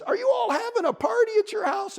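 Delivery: very high, piping question; curious bunny. A narrator's reading. Bunny asks beaver about the party, piping. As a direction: pipe it up eagerly in bunny voice.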